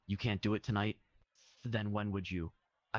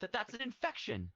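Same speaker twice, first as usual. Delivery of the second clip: put-on high squeaky anime boy voice, alarmed; shocked character voice.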